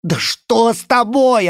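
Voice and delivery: high, loud, slow tearful yell; voice breaking shout. Acted. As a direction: shout through tears, voice cracking. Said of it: "орал Рен. У неё срывался голос". (крик сорвавшимся голосом)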